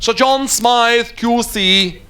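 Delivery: high, very slow level announcement; flunky announcing guests. Acted. Flunky at the hotel door announces guests, drawn-out formal call. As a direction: draw the name out like a ceremonial announcer. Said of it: "the flunky on the door with the staff announcing them".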